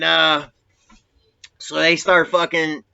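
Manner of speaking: normal talking voice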